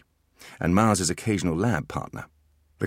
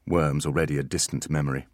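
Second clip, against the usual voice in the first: ordinary delivery, nothing marked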